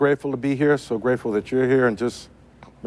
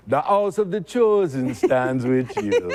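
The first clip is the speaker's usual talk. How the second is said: Draka line through laughter; 6 semitones higher, slower than usual. Acted. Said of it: "Okay, [name]".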